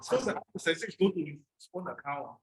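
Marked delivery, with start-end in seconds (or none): none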